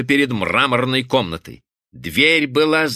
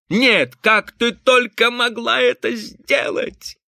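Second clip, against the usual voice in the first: slow, high-pitched indignation; outraged reproach; 11 semitones higher, slower than usual. A narrator's reading. Husband reproaches his wife for hurting herself, outraged. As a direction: demand it in disbelief, pitch way up.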